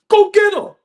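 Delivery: high, loud call to go get him; shouted demand. Real, not acted. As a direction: yell it like a fed-up fan. Fan demanding the trade.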